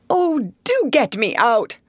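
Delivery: high, slow plea for help; desperate cry. A narrator's reading. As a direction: beg to be pulled out. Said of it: "cried the unfortunate Mister Bunn".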